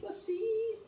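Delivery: very high, drawn-out squeak; playful high echo. Real, not acted. Playfully echoes the student's answer.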